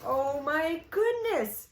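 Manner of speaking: usual speaking voice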